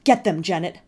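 loud fierce urging shout. Acted. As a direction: urge him on at full voice. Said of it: "I shouted".

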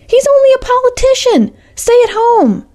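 Alice May screams at her foster parents in disbelief. A narrator's reading. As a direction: shriek the word out in outrage. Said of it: "screamed Alice May".

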